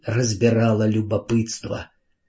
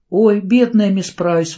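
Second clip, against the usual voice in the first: high, louder, slowed pitying cry; exclaiming; 9 semitones higher, 5 dB louder, slower than usual. A narrator's reading. Carrie runs up to the fallen teacher, exclaiming. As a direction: burst out in pity. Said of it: "воскликнула Кэрри".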